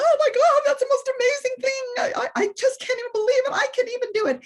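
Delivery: high, gushing mock-amazed squeal; gushing imitation. Student gushing over his trick to Oprah, high mock-amazed squeal. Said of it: "here he is with Oprah going".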